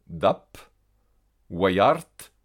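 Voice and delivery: "dap" ending in P, "wayart" in T; devoiced final B, D. Shown as a demonstration. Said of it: "se prononcent peu ou te". (« dap » en P, « wayart » en T final)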